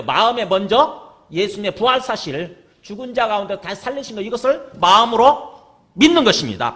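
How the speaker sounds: impassioned pulpit delivery; full-force preaching. Real, not acted. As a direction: preach it out to the congregation.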